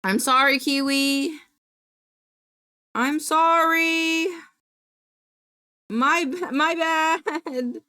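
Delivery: high, drawn-out whiny sorry; plaintive apology. Real, not acted. Streamer to the timed-out viewer, plaintive apology. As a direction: wail a sheepish apology.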